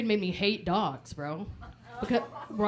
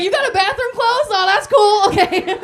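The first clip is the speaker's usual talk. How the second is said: mock nervous; 9 semitones higher, 9 dB louder than usual. Nervous at a party.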